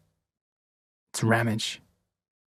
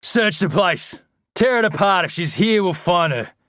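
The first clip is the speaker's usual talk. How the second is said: a high, loud yell at his men, higher still on the second order; 7 semitones higher, 4 dB louder than usual. A narrator's reading. Ramage yelling orders to his men, loud yell. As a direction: bark the search orders to the whole house. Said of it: "He turns and yells".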